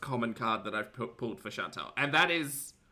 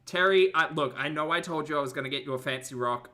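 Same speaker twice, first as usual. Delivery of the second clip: ordinary talk, nothing marked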